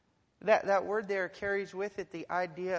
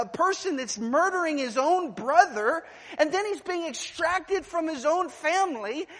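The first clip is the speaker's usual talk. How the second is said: high, loud pulpit build; full-throated sermon climb; fervent preaching; 9 semitones higher, 9 dB louder than usual. Acted. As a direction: preach it out, rising with urgency. Preaching rises to full force.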